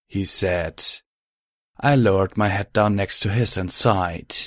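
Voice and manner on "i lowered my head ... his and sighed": usual speaking voice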